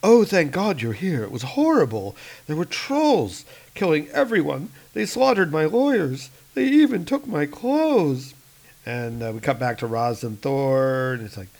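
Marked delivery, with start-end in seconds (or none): 0.0-8.3 higher whiny complaint; lightly voiced whining victim; whining businessman. [name] voiced.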